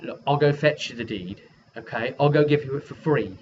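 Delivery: usual speaking voice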